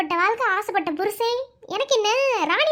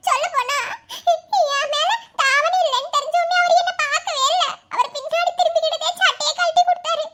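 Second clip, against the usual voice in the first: high, sobbing plea of innocence; tearful pleading; 5 semitones higher than usual. Acted. Younger sister begs her brother to believe she did no wrong, crying. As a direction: plead through tears, voice pitched up. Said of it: "எதுக்கு நீ அழுதுக்கு இருக்க?".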